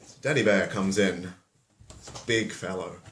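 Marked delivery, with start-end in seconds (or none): none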